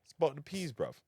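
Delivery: playful mock London accent; London slang accent, tossed out in fun. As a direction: put on a light London accent. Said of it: "Maybe a London accent".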